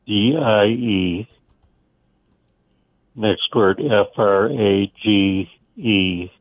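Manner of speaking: letters spelled out slowly, one by one; deliberate letter-by-letter dictation; slow spelling. Shown as a demonstration. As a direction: spell it slowly for a note-taker. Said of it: "do this title again so I can kind of phonetically write this".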